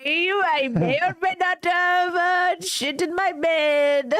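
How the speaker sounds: put-on Indian voice, slowing to a confession; hammed-up Indian accent; mock Indian accent. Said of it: "the skeptical Indian guy".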